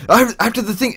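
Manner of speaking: high-pitched angry rant; retold rant. Manicloud blaming the narrator, high angry rant.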